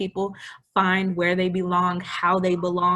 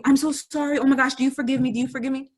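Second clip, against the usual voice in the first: higher, begging for forgiveness; pleading over-apology; 6 semitones higher, 3 dB louder, faster than usual. Retold or imitated. Guilty friend begging the misgendered person, higher and pleading. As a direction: lay the guilt on thick. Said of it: "if I'm talking to you".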